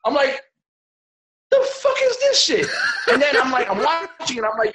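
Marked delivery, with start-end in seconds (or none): 1.5-2.7 much higher, louder incredulous yell; exasperated outburst. Retold or imitated. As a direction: blurt it out in disbelief. Reacting to absurd movie.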